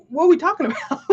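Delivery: amused disbelief with a laugh. Real, not acted. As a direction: break into a laugh on the question. Laughing at the idea in a quote.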